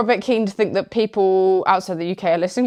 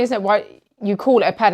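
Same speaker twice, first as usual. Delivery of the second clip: ordinary talk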